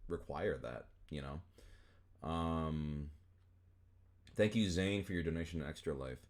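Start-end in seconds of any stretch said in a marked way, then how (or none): none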